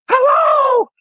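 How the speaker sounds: very high, shouted puppet greeting; squeaky cartoon puppet yelling hello.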